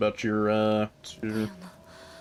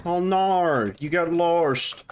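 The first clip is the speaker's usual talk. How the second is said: higher, slow mock Australian drawl; bad Australian accent; 6 semitones higher, much slower than usual. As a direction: ham up an Aussie, badly. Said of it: "not a good Australian accent".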